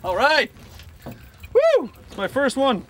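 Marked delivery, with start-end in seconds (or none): 1.0-1.9 high joyful yelp; excited whoop. Real, not acted. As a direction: cheer the first catch. First fish caught.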